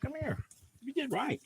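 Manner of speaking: high, soft reassuring call; calling the scared dog over softly; coaxing dog voice. Real, not acted. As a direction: coax her gently, up high.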